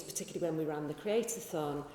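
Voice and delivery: normal talking voice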